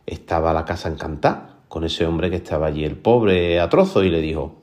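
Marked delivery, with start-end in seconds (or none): none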